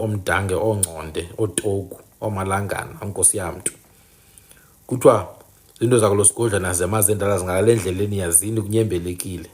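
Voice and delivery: low, soft chanted praise of chiefs; clan praise recitation. Acted. Closing the video with praise to the ancestors, low chant. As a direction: recite the clan names like an invocation.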